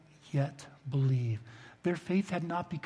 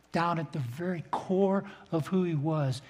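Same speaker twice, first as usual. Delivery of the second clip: normal talking voice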